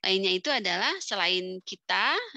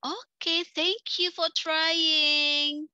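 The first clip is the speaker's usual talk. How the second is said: bright, slow, encouraging teacher praise; positive feedback; 8 semitones higher, 4 dB louder, much slower than usual. Shown as a demonstration. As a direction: reward the student for trying, very slowly. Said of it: "respon kita harus positif".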